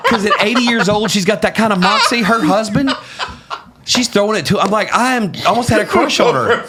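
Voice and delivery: talking through laughter, voice lifted high; can barely get the words out for laughing. Real, not acted. Telling podcast hosts about the old lady, laughing.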